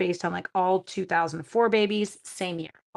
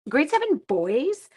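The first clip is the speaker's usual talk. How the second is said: ordinary talk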